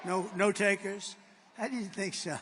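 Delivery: normal talking voice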